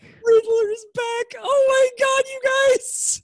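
high, loud, slow squeal of joy; excited squeal. Real, not acted. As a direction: squeal like a thrilled fan. Excited squeal about Riddler.

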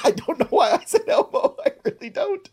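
giggling through the words; cracking up at himself; high laughing aside. Real, not acted. Laughing at own impression.

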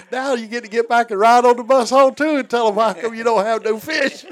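buddy mocking the sleeper, gleeful; teasing jab. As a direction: rub it in with glee. Friend teasing the drunk.

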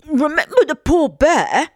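high, loud, food-muffled plea; husband barely able to speak for food; mouth very full. A narrator's reading. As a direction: urge it through a stuffed mouth. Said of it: "mouth so full that he could hardly speak".

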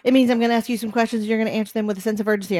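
rapid, urgent explanation; fast talking. Real, not acted. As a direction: rush through it with urgency. Explaining the speed round.

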